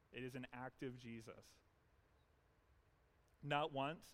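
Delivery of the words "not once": ordinary delivery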